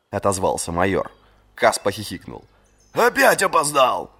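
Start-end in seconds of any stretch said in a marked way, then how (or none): 2.9-4.1 slow, puffed-out mocking voice; puffed cheeks mock. A narrator's reading. Friend teasing the late captain, puffed-cheek mockery. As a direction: puff your cheeks and tease. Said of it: "сказал Чален, гором надувая щёки".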